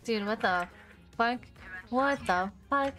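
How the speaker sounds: ordinary talk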